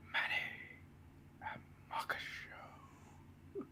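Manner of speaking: slow breathy ASMR whisper. Shown as a demonstration. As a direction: whisper it slowly into the mic. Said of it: "this is A S M R".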